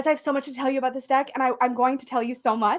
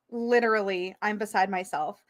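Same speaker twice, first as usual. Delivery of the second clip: ordinary talk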